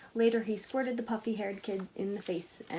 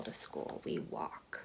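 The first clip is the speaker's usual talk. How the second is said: unvoiced aside, softly; whispered aside; 7 dB quieter, slower than usual. Real, not acted. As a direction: let it slip as a secret. Aside to listeners.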